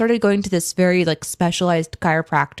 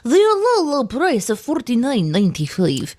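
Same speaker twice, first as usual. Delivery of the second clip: ad announcer voice; 4 semitones higher than usual. Pretend. Mocking a sales pitch.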